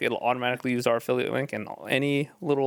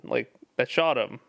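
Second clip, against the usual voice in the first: normal talking voice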